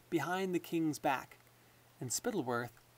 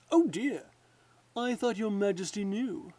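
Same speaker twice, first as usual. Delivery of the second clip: mock alarm, high and louder on oh dear; pretending to be startled; 5 semitones higher, 4 dB louder than usual. Lord Spittleworth faking alarm to King Fred, mock startled. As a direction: feign surprise to draw the king in. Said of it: "pretending to be startled".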